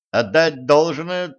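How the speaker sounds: plain reading voice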